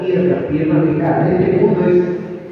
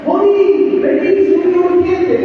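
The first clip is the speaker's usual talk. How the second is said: a higher, urgent call from someone else; 8 semitones higher, slower than usual. Retold or imitated. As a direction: hail her like a worried colleague. Someone calling Moni to come.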